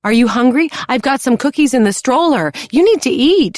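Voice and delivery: high, loud pushy mother insisting; fussing mother. A narrator's reading. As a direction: press food on him, rising eagerly. Mother character voice.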